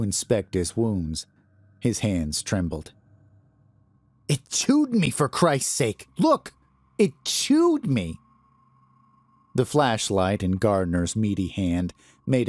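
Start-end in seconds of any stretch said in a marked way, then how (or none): 4.3-5.1 much higher, frantic outburst; panicked cry. Acted. Bitten Cyphers shows the guard his wounds, panicking. 5.1-6.5 high, frantic, Look shrieked; frantic plea. Acted. Bitten Cyphers begs the guard to look, frantic. Bitten man shows his wounds. 7.0-8.2 high, slow, stunned repeat; shocked insistence. Acted. Bitten Cyphers insists to the guard, slow and stunned.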